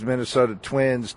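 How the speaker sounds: normal talking voice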